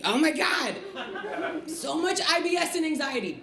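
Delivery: high, put-on neurotic cry; mock anxious wail. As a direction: wail in exaggerated anxiety.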